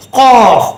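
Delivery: காஃப் as a rounded 'qoof'; heavy, held Arabic Qaaf for காஃப்; deep throat q. Shown as a demonstration. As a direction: make the k of காஃப் far back in the throat. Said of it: "தொண்டையில் வரக்கூடியது".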